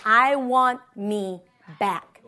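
slow, low, word by word; deliberate resolve. Retold or imitated. Repeating her lunchtime prayer, deliberate resolve. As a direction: spell out the decision slowly and firmly.

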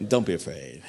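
whispering comfort to the scared; soft Jesus. Acted. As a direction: say it low and gentle. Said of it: "the best part is".

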